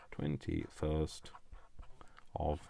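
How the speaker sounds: Twenty-first whispered slowly; hushed, slow, reading the date. Real, not acted.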